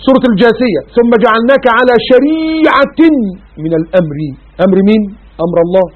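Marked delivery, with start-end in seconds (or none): 1.0-4.2 Quran recitation. Acted. Recites verse.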